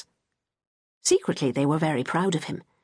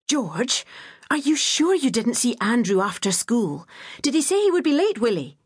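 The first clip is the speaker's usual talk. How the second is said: anxious mother pressing, rising high; a worried mother demanding answers again; 6 semitones higher than usual. A narrator's reading. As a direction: insist on an answer, voice climbing. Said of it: "she demanded for the umpteenth time".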